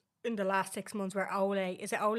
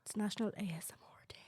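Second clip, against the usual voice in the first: ASMR whisper; 8 dB quieter, much faster than usual. Acted. Announcing ASMR day in whisper.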